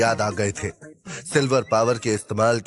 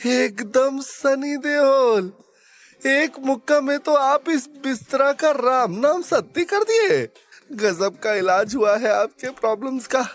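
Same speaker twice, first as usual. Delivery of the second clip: thrilled Bihari sidekick; 12 semitones higher than usual. Acted. Sidekick marvelling at guruji smashing the bed, thrilled. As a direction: crow with admiration in a high sidekick voice.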